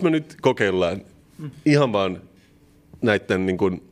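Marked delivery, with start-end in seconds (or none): none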